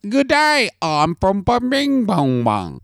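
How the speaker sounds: high-pitched mock Australian greeting; hammed-up Australian accent, pitched high. Shown as a demonstration. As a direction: do a silly, drawn-out Australian. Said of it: "can you do an Australian accent".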